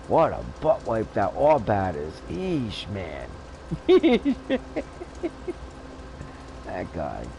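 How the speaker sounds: ordinary talk, nothing marked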